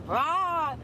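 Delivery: short hammed-up sob sound; mock crying wail. Retelling crying in the club bathroom, mock sob.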